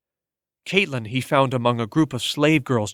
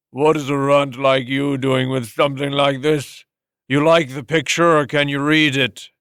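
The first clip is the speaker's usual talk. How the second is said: a louder, mocking master; sneering old master; 3 dB louder than usual. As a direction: taunt the runt with contempt.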